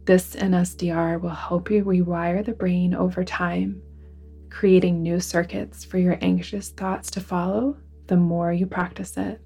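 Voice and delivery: ordinary talk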